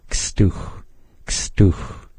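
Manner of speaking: Kstuch starting with a soft whispered ks; teacher showing the soft ks onset. Shown as a demonstration. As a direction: Kstuch said quietly, breathy ks up front. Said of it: "that soft ks sound is at the start of the word".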